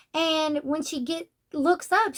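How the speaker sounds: ordinary talk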